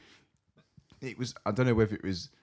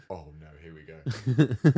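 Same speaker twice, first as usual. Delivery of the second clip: dreading trouble; 10 dB louder, much slower than usual. Retold or imitated. Dreading the fight about to start.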